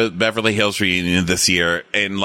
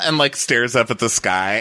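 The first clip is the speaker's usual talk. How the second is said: usual speaking voice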